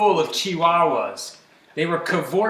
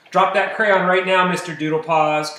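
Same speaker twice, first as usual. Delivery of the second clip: a firm, louder motherly order; commanding; 2 semitones higher, 5 dB louder than usual. A narrator's reading. Mama catches her kitten drawing on walls, commanding. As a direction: order the child to drop it at once. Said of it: "commanded Mama Junebug Jones".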